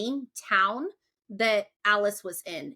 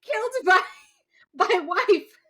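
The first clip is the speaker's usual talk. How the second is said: laughing; 8 semitones higher, 4 dB louder than usual. Real, not acted. Cracking up at own joke.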